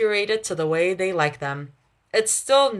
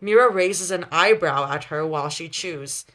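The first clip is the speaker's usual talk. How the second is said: ordinary delivery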